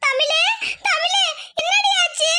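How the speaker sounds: high, tearful cry to a daughter; distraught crying. Acted. Mother asking daughter what has happened, distraught crying. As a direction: call out in rising anguish.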